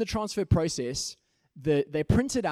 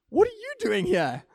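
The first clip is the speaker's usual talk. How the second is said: high squeaky shock, much higher; surprised captain; 13 semitones higher than usual. Retold or imitated. Police captain startled to see the pastor, surprised high squeak. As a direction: jump way up in pitch, startled.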